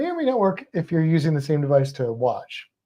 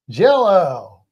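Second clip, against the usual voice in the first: [name]; 7 dB louder than usual. Acted. Host asking which pronunciation was meant, [name] impression. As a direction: stretch JELL-O out in a swooping impersonated voice. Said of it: "hard to do a [name] accent".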